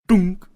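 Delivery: loud, high "dunk" of an impact; cartoonish collision noise; imitated thud. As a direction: punch out a sudden bonk sound effect.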